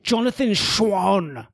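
slow, drawn-out British-accent mimicry of a name; mock British accent. Co-host mimicking reporter saying own name, mock British. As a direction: announce it in a hammed-up muddled British accent. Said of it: "muddled British accent".

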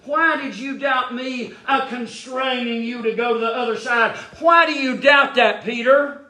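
high, insistent pulpit questioning; full-force preaching. Real, not acted. Preacher as the Lord questioning doubting Peter, insistent high preaching.

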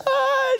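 high pained yelp; cry of pain. Retold or imitated. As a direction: yelp as if it hurts. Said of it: "she's like".

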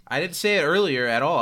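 ordinary talk, nothing marked

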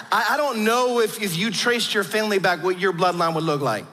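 ordinary talk, nothing marked

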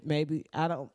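ordinary talk, nothing marked

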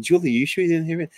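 insisting his daughter must have heard it; urgent question. Retold or imitated. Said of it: "I said".

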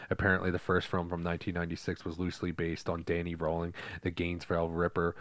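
low, even fact-reading voice; reading aloud. Real, not acted. Host reading friends the true crime behind Scream, level reading voice.

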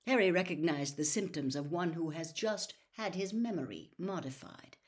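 plain reading voice

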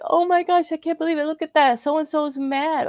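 high, catty gossip voice, slowing on the last words; mocking gossip. Mimicking the manager mocking her to the new trainee. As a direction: ham up a snide, gossiping coworker, way up high. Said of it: "She's like".

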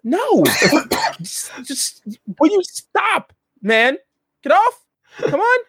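high, squealing stammered denial; acted panicky, exasperated objection; flustered captive. Unmasked captive denies and resists.

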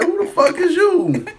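high, loud, indignant demand; retold angry challenge. Man retelling how he confronted someone, indignant shout.

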